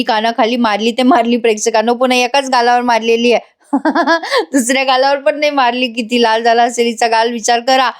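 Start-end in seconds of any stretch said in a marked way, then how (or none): 3.7-4.5 short laugh. Real, not acted. Amused at repeated slaps.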